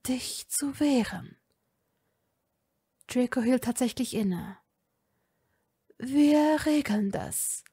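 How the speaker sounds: slow, hushed and steadying; calm. A narrator's reading. Father calming his son Draco, slow and hushed. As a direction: soothe a struggling son. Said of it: "Lucius sah seinen Sohn ruhig an".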